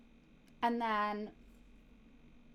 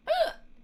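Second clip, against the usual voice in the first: gasp; 4 dB louder than usual. Real, not acted. Reacts to a stream disconnect.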